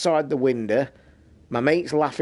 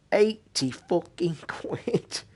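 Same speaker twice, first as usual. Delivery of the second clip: laughing disbelief; 5 semitones higher, much slower than usual. Real, not acted. Outraged at dock price.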